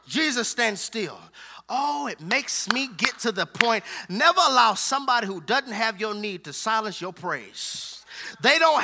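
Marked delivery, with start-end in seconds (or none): none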